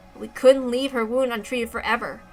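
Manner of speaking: normal talking voice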